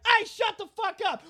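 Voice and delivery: high-pitched shout to shut up; mock angry yell. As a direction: yell it high, as a comic bully. Acts a film's neighbours yelling insults.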